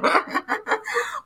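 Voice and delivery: quiet high laugh; soft high laugh. Real, not acted. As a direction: laugh lightly at yourself. Laughs at her own simple answer.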